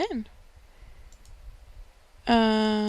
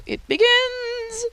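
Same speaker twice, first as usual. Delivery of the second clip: dramatic announcement; 12 semitones higher, much slower than usual. Pretend. Dramatic game moment announcement.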